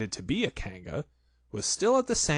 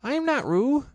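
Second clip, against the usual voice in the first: high, loud insistence on NOT; loud protest; 5 dB louder than usual. A narrator's reading. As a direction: Piglet shouts he is not Roo. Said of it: "said Piglet loudly".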